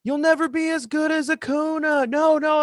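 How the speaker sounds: mock a bratty kid heckling from the stands. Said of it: "he's just yelling".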